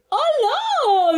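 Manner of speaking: hello mucho más agudo, alegre; grítalo con asombro feliz; saludo entusiasmado. A narrator's reading. Max saluda al bosque. (saludo agudo y entusiasmado)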